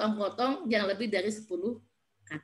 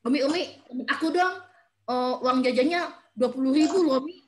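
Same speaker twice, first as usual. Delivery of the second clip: put-on child wheedling mother; 2 semitones higher, 3 dB louder than usual. Child telling mother about a friend's allowance, imitated wheedling. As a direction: plead like a kid showing off to mum. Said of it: "pernah anak saya gini".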